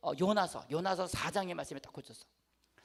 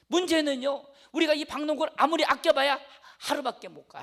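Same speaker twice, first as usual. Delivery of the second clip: preaching; 5 semitones higher, 7 dB louder than usual. Acted. Preaching climax.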